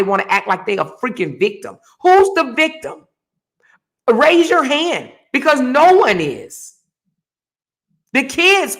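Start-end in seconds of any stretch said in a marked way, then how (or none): 2.0-6.7 much higher and louder, calling out the crowd; raised, heated call-out; loud challenge. Real, not acted. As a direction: demand an answer from everyone. Rhetorical challenge to audience.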